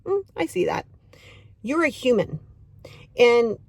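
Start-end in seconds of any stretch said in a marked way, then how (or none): 0.1-0.9 lightly voiced understanding self-talk; calm self-acceptance. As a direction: ease into it like you're kindly noticing. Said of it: "but we wanna go".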